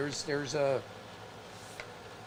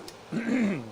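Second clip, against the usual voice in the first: quick throat clear before speaking; 6 semitones higher than usual. Real, not acted. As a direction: clear the throat mid-sentence. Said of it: "excuse me".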